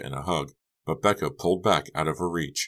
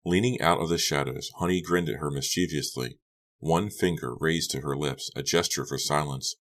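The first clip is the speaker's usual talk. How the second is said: ordinary delivery, nothing marked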